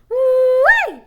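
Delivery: a loud, high whoop; excited yell. A narrator's reading. Billy yells gleefully while chasing the pig. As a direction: whoop it as you dash out. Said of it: "yelled Billy".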